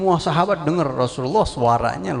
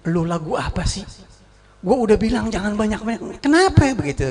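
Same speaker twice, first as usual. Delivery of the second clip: annoyed scolding; 4 semitones higher than usual. Retold or imitated. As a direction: nag like an annoyed Betawi wife. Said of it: "orang Betawi bilangnya". (omelan istri Betawi yang kesal)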